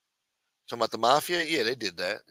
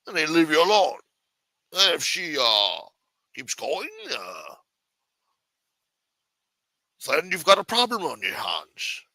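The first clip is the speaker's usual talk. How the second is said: put-on Sean Connery accent; 4 semitones higher than usual. Washington advising on wives, Scottish Connery accent. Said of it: "If he didn't sound like Sean Connery".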